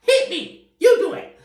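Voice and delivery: hammed-up rant in a very high, strained pitch; pissed-off Todd, exaggerated. Imitating a pissed-off husband for listeners, over-the-top angry. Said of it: "That's, that's how he talks".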